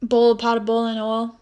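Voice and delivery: ordinary talk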